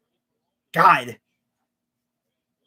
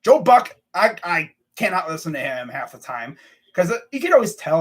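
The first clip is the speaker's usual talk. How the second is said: ordinary talk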